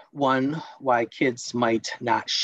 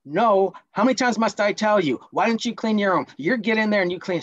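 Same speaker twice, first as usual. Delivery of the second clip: exasperated parent, higher and louder; nagging parent; 8 semitones higher, 3 dB louder than usual. Acted. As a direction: nag the child either way. Role-play critical parent after answer no.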